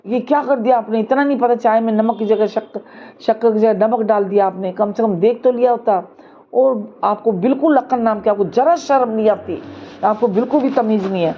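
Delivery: plain reading voice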